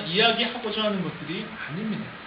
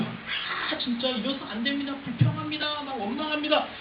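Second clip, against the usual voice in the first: higher-pitched mock grumbling; grumbling complaint; 5 semitones higher, 4 dB quieter than usual. Preacher voices the people resenting Moses, grumbling in a higher pitch. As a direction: whine out the complaint, acting the crowd. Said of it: "원망의 말만 합니다".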